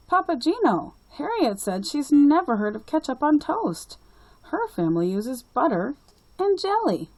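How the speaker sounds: put-on high, eager little-girl voice; a small girl telling her grandpa; young girl Lucy.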